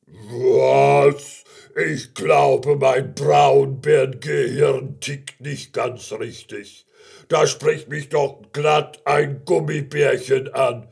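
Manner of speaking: loud, booming, drawn-out bear voice; deep bear voice. Acted. Giant brown bear looks for tiny gummy bear, deep booming voice. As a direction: boom slowly like a huge bear. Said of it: "mit tiefer, tiefer Stimme".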